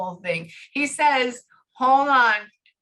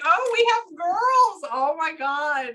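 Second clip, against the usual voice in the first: high, drawn-out cooing exclamation; hammed-up squeal of delight; mock gushing; 8 semitones higher, 4 dB louder, slower than usual. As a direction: gush it slowly, way up high. Acts own gushing reaction to girls.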